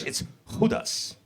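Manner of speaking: "Judas" said the Spanish way, with a breathy h at the start, "Hudas"; "Judas" with a soft Spanish h sound, not the English j; Spanish pronunciation. Shown as a demonstration. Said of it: "but in Spanish".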